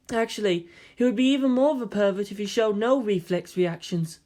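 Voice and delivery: lightly voiced matter-of-fact girl; Ray voice. Ray's voice.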